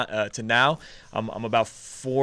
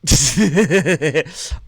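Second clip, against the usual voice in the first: loud laugh; 6 semitones higher, 10 dB louder than usual. Real, not acted. Reacts to friend's mock order.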